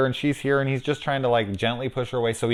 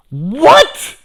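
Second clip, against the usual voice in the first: high, loud rising what; incredulous what; 13 dB louder than usual. Retold or imitated. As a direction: yelp it in disbelief. Incredulous reaction.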